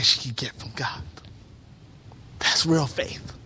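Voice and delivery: breathy hushed aside; hushed whispered emphasis. Real, not acted. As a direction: drop to a whisper on it. Preacher drops to whisper.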